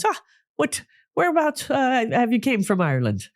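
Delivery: high, lilting Irish brogue; Irish barman. Retold or imitated. Irish barman asking the host where he's from, lilting brogue. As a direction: pitch it up like a chatty Irish barman. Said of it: "he goes".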